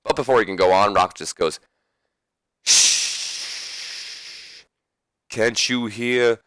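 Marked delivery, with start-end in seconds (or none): 2.6-6.4 put-on hushing then slow Vince Russo accent. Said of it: "That was a Vince Russo accent for The Rock".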